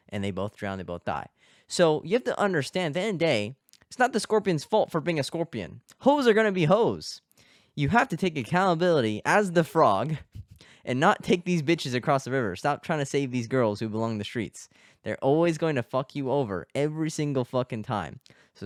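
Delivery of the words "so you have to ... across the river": high, loud, forceful moral; emphatic lecturing. Real, not acted. Telling guys the moral of the fable, emphatic and raised. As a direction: drive the lesson home with raised pitch and volume.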